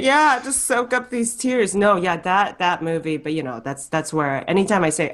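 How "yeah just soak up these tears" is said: mock weepy.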